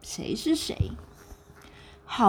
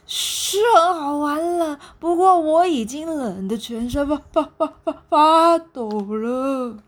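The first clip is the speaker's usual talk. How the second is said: shivering with cold; 6 semitones higher, 5 dB louder than usual. Acted. Freezing monkey telling the penguin he's shaking, stuttering. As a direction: chatter through the cold. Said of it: "冷到一直打跌缩".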